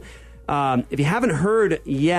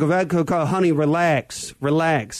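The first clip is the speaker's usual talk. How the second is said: Russian mobster. Retold or imitated. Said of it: "He sounded like a mobster".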